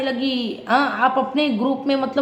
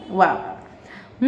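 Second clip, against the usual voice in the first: slow, drawn-out sarcastic wah wah; 4 semitones lower, 4 dB quieter, much slower than usual. Real, not acted. Vlogger mocking a contestant's words, slow and sarcastic. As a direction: stretch each wah out mockingly.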